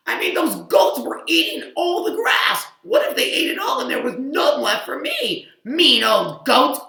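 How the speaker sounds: loud high whiny complaint; upset cow. Acted. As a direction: complain loudly about the goats. Cow upset about goats.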